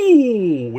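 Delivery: high, loud excited ooh. Real, not acted. Gamer reacting to a big dunk, high excited ooh. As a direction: let out a sharp thrilled ooh.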